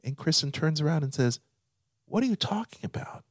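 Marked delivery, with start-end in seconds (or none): 2.0-3.2 high, whispered mock bafflement; hushed, airy imitation of her question. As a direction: whisper it as the puzzled wife. Wife's baffled question, acted out.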